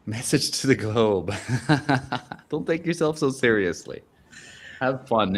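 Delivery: usual speaking voice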